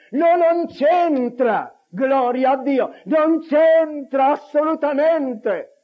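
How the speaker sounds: high, loud, drawn-out denial; emphatic preaching. Real, not acted. Preacher rejecting human reason before a miracle, emphatic. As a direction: thunder it out slowly to the congregation.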